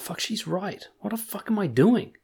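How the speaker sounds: sudden high dismayed self-reproach; shocked realization. Retold or imitated. Realizing a follower's criticism was right, shocked high voice. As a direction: caught out by a fair point, voice jumps up.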